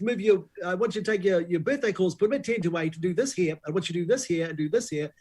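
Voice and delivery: high, insistent critic on the phone; Paul Holmes. Retold or imitated. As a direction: rattle off orders as an imitated caller. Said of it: "He's like".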